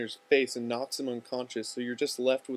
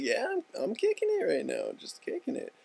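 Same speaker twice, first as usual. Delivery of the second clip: casual confirmation; 13 semitones higher than usual. Acted. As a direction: play it cool for your excited buddy. Casual answer to amazed buddy.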